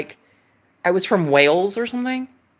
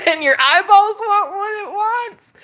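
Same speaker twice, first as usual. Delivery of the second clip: giddy laugh; 11 semitones higher than usual. Real, not acted.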